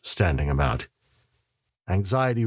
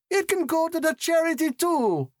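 much higher, sweet woman's voice; sweetly. A narrator's reading. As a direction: sweetly settle it for charity. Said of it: "Alicia said sweetly".

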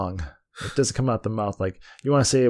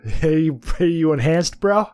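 put-on teen bro; 2 semitones higher, slower than usual. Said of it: "a bunch of fucking eighteen-year-old bros".

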